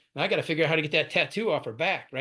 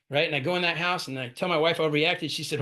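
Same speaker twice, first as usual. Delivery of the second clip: normal talking voice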